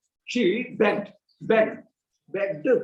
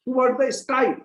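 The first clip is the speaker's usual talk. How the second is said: usual speaking voice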